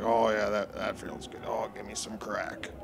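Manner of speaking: hammed-up crack-smoking fighter, kept quiet; put-on voice of a blissed-out tough guy; Don Fry. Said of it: "work on my Don Fry".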